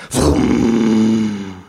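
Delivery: loud imitated lawnmower roar; long, loud mouthed vroom; engine starting. A narrator's reading. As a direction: rev up like a motor catching. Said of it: "der Motor springt an".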